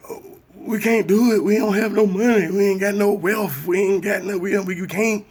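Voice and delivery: very high, loud whiny mock voice; mocking hopeless whiner. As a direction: whine it like someone who's given up. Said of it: "hopeless motherfucker that like".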